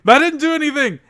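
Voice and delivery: high, loud panicked scream. Retold or imitated. Piss-soaked man fleeing the pub, screaming loud. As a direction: yell it as you flee.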